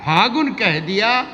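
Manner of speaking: high, slow drawn-out verse; romantic shayari. Acted. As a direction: stretch the line out high. Poet recites ghazal.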